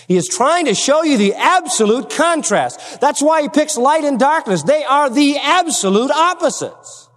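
emphatic pulpit proclamation; full-force preaching. Real, not acted. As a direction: preach it out, leaning on key words.

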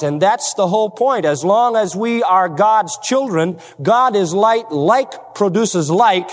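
normal talking voice

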